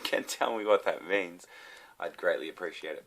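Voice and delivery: ordinary delivery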